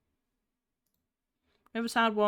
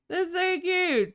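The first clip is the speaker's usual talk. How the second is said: gushing; 10 semitones higher, slower than usual. Real, not acted. Shows the star-eyes expression.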